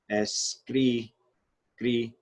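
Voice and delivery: "escri, cri" with the "cri" held and repeated alone; single out "cri" in "escri, cri"; stressed middle syllable. Shown as a demonstration. Teacher isolating the stressed syllable for students, "cri" stressed. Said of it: "stressed syllable".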